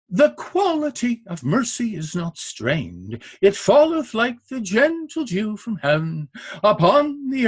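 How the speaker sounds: measured Shakespeare verse with every second syllable lifted; beat-stressed recitation. Shown as a demonstration. Lecturer demonstrates Shakespeare's five-beat line, beat-stressed recitation. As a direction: recite "The quality of mercy" weak-STRONG, counting out the five beats. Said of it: "he has five beat lines".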